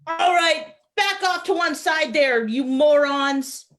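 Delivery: put-on high, officious Fargo-accented order; Minnesotan policeman. Policeman herds spectators aside, commanding Minnesotan accent. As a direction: order the crowd back in broad Minnesotan. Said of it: "policeman. Give us your best Fargo accent".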